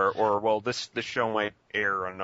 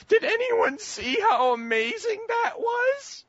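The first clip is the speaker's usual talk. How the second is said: much higher, slow squealing gush; gushing fangirl; 6 dB louder, slower than usual. Retold or imitated. Imitating a girl leaving her seat, high slow gushing. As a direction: swoon over the movie like a starstruck girl. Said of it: "one girl ... going".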